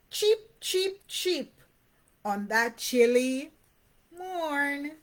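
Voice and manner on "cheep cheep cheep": high peeping chick cheep. A narrator's reading. Chicks singing on a chilly morn, high cheeping. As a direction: chirp like little chicks, high. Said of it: "who sang out their song".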